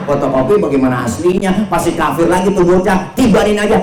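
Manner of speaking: exasperated, rising to high and loud; annoyed angel. Acted. Annoyed Jibril urging the Prophet to crush the unbelievers, exasperated. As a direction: argue impatiently as the fed-up angel. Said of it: "Jibril kesel".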